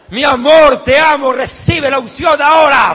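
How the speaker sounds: mocking a wife shouting her love at her husband; mock shouting lover. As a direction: shout it like a couple going wild over the anointing. Pastor mocks a couple's ritual. (esposa gritando en burla, tono exagerado)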